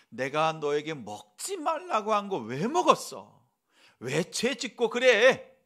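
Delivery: put-on loud, slow rebuke of a wayward child; scolding tone. Shown as a demonstration. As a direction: scold him for eating the fruit. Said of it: "이렇게 나무라는 투로". (나무라는 큰 목소리로 꾸짖듯이)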